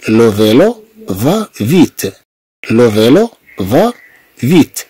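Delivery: لو فيلو فا فيت said slowly, word by word; careful classroom dictation pace; slow model reading. Shown as a demonstration. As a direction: read it slowly for pupils to repeat. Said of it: "teacher models the sentence".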